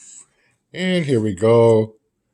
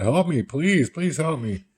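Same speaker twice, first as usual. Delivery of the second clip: 1 mock begging; 5 semitones higher, 5 dB quieter than usual. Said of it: "begging Matt".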